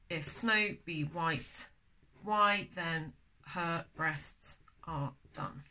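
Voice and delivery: very slow, measured word-by-word verse; slow scansion reading. Shown as a demonstration. Teacher working out the scansion of a poem, slow and deliberate. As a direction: space out each word to test the beat.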